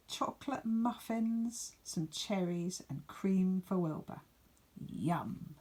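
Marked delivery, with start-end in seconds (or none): 4.9-5.6 soft savouring whisper; whispered yum. Acted. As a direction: breathe it like a tasty secret. Reader savours the picnic food.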